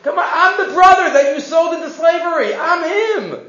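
high, loud, laughing mock friendliness; hammed-up "we're all friends" with a laugh; mock cheerful appeasing. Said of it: "is that the formulation that one would say".

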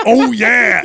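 booming high "Oh yeah" burst; Kool-Aid Man. Retold or imitated. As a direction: bust in with a loud "Oh yeah". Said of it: "Like the Kool-Aid guy".

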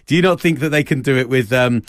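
usual speaking voice

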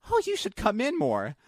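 high-pitched chirpy clerk; store lady imitation.

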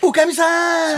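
calling out. Acted. The stage line again.

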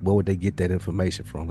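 ordinary delivery

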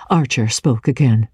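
plain reading voice

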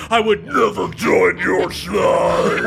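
slow, roaring pro wrestler shout. Acted. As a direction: bellow it like a wrestler calling out a rival. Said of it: "hear that pro wrestler".

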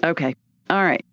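normal talking voice

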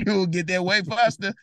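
laughing through the repeat of own line. Real, not acted. Repeating his own advice with a laugh, laughing. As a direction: chuckle it out, amused. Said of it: "repeats own line laughing".

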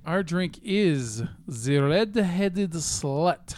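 slow, drawn-out mock-serious read; odd slow reading. Reading the drink of the day to his co-hosts, slow and drawn out. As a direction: drag the words out like a solemn announcement. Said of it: "Why are you talking like that?".

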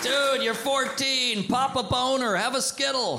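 exasperated dude. Retold or imitated. Comic answering teen.

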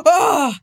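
gasp, then a high-pitched "oh"; high excited gasp. Real, not acted. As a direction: let out a winded, high cry. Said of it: "my voice got higher and higher".

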